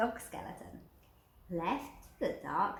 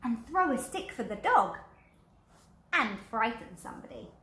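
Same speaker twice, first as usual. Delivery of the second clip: louder, eager little skeleton; keen to go out and play; 4 dB louder than usual. Acted. Little skeleton proposing fun, louder. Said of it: "said the little skeleton".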